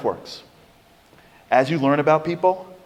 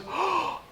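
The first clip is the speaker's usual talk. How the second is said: shocked gasp; 10 dB quieter than usual. Retold or imitated. Woman shocked by the stranger.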